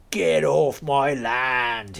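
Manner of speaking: slow, drawn-out farmer's yell; mock yell. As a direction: bellow it like an angry landowner. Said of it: "he yelled".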